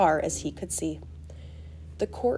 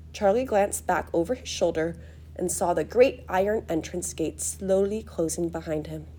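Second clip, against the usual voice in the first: ordinary delivery, nothing marked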